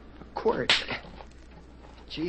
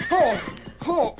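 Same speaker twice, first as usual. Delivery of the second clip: startled cry; 12 semitones higher, 5 dB louder than usual. Acted. Thrown from frightened horse.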